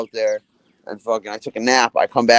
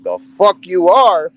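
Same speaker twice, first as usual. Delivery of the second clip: higher, louder indignant retort; defiant; 8 semitones higher, 6 dB louder than usual. Retold or imitated. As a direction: snap back at the aunt. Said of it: "I'm like".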